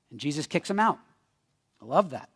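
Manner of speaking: normal talking voice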